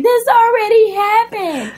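high, whiny mock despair; high mock-tearful whine; distressed. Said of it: "I was like".

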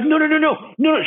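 high-pitched rapid-fire panicked denial; freaking out. Retold or imitated. Caught-out woman denying the psychic's claim, freaking out. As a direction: imitate someone frantically blurting out no. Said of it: "She freaks out".

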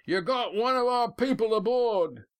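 very high, sustained hail between boats; high far-carrying shout. A narrator's reading. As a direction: call it high so it carries across. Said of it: "turned away from his skipper and shouted".

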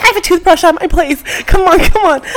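high, loud urgent pushing; hurried urging. Retold or imitated. Friend hurrying her.

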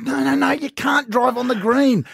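father correcting his daughter on the golf green; exasperated dad. Retold or imitated. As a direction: spell out the rule firmly. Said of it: "I said".

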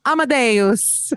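high, drawn-out mock-Italian title; Italian accent. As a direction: say it slowly with a playful Italian accent. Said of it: "that famous Italian film".

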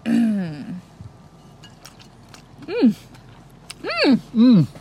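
long, rising mmm of pleasure; drawn out delight. Real, not acted. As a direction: savour each bite out loud. Tasting the finished sandwich.

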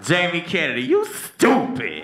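razz him across the mall. Retold or imitated. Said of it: "they're like".

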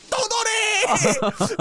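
long, very high mom shriek; imitated scream. Mom screaming back at her son, screaming. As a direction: stretch the word into a wailing yell. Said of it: "they're screaming at each other".

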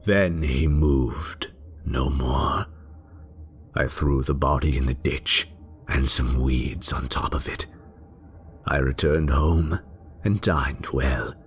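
usual speaking voice

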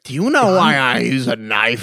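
a hint of sinister villain impression; Joker. Quoting a film villain to friends, lightly menacing impression. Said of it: "what the Joker said about killing people".

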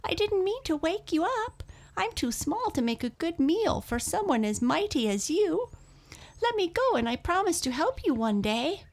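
very high, fast little mouse voice; pleading mouse cry. A narrator's reading. Caught mouse begging the lion for release, crying out. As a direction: plead in a rapid tiny squeal. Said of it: "cried Mouse".

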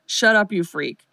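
normal talking voice

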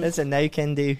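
playful mock-British delivery; amateur British accent, stretched "no". Shown as a demonstration. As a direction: put on a British accent for fun. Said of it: "with a British accent".

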